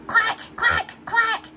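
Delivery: high, quacking duck voice; duck quacks. Acted. Ducks arriving at the picnic, high quacking.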